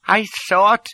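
high, startled little doctor; stammering. A narrator's reading. Anxious doctor faces the supposed prince, stammering. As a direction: falter on a high, nervous pitch. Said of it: "he stammered".

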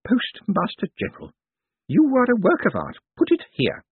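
put-on high, admiring old woman; feisty old lady. A narrator's reading. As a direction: address a rogue with relish as an elderly lady. Old lady greets Moist.

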